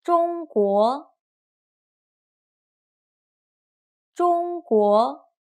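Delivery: slow drill of "中国", level then rising; first plus second tone. Shown as a demonstration. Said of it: "first tone plus second tone".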